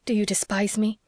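ordinary delivery, nothing marked

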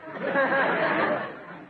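high breathy giggle; a quick airy snicker under the breath. Real, not acted. Host snickering at Sidney's boast, airy giggle.

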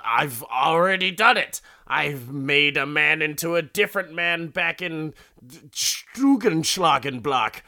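loud, high boasting mad doctor; mad scientist. Retold or imitated. Doctor boasts of his past success, manic and loud. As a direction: proclaim it like a hammed-up mad scientist. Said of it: "in typical mad scientist fashion".

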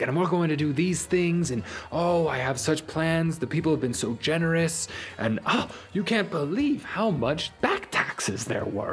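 high-pitched, gushing official voice; enthusiastic mayor. Acted. Mayor boasting about plans for the town, high and gushing. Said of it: "he's like".

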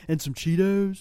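lazy hippie voice; stoner voice. Acted. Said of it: "that's my hippie voice".

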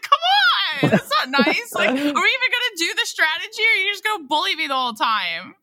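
high, loud whiny complaint; whiny high protest. Retold or imitated. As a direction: protest in a high squeaky whine. Whining at friends who gang up.

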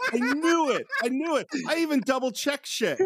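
blushing host admitting the blunder with a laugh; embarrassed laugh. Real, not acted. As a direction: groan through laughter at yourself. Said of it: "I'm literally blushing".

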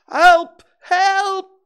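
very high, loud panicked cry; Piglet crying out. A narrator's reading. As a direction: scream for help as a terrified little pig. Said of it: "cried Piglet".

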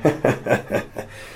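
strained broken laugh; weird croaky laugh. Shown as a demonstration. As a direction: force out a cracked laugh. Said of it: "my laugh at that point was coming out really weirdly".

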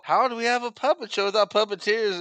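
fretting Dee Dee quote. Retold or imitated. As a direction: imitate a frantic woman, pitch jumped up. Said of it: "And Dee Dee's all".

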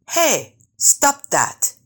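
high, drawn-out storybook shout. A narrator's reading. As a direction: call out a sharp warning, pitched well up. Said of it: "Brother Rabbit shouted".

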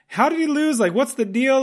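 high, exasperated retold bafflement; baffled disbelief. Moviegoer recalling the dad losing the game, baffled. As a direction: replay your confusion, pitch jumping high. Retelling own baffled reaction.

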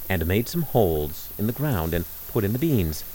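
ordinary delivery

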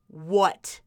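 low, blunt one-word answer; curt, unwelcoming pickup; rude phone answer. Shown as a demonstration. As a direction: answer the phone like the caller is a nuisance.

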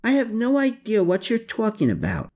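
suddenly on the defensive. A narrator's reading. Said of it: "with sudden defensiveness".

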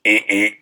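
two short mock siren bursts; imitated weird siren blip. Describing the sound that cleared the crowd, imitated short siren blips. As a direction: mimic a police car's quick double whoop. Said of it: "like, weird".

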